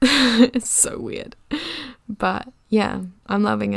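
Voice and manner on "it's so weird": laughing as it comes out, drawn out; amused laugh. Real, not acted. Host marvelling at her own company news, amused laugh. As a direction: let a real chuckle into the words.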